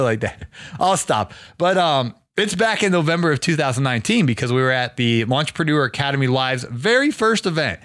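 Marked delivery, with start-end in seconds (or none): none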